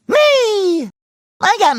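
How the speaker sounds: high, held-out gleeful cry. Acted. Answering who lives in a pineapple, gleeful high cry. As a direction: burst out proudly on a high note. Answers own theme question.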